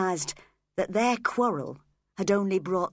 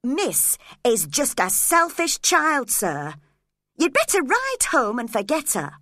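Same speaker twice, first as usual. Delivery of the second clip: raised, urging call; 6 semitones higher, 5 dB louder than usual. Acted. Ellen calling to Edgar to ride home. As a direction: urge him firmly to go. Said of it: "I called out to him".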